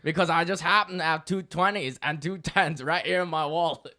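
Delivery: hammed-up Australian accent, loud start; mock Aussie barman line; playful attempt at an Australian accent. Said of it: "this would be so much better as an Australian accent".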